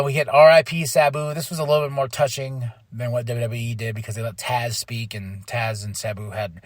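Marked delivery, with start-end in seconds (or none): none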